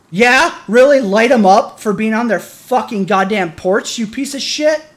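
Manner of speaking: loud, high, biting outraged questions; real furious sarcasm; angry sarcasm. Real, not acted. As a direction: jab each question at the cops. Sarcastic furious rant at police.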